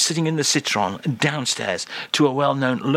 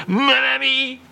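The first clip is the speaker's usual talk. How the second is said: much higher, louder mon ami; squeaky interjection; 11 semitones higher, 4 dB louder than usual. Acted. Charles gets the last word, high chirpy interjection. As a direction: pop in high.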